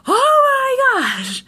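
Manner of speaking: high, loud, slowly stretched exasperation; imitating angry brother. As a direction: wail it out like a fed-up brother. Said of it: "He's getting really, really angry".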